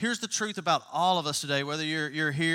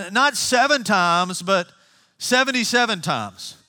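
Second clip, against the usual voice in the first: slow, louder, weighty correction; Jesus correcting; 3 semitones higher, 4 dB louder, slower than usual. Acted. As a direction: drag it out to drive the point home. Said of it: "he says".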